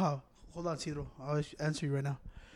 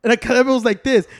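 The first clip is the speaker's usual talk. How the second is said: usual speaking voice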